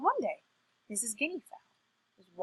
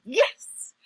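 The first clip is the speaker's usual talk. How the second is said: short, loud, higher yes; triumphant cheer; 5 semitones higher, 8 dB louder than usual. Acted. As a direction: celebrate a trick that worked. Trickster triumphs as Bull vanishes.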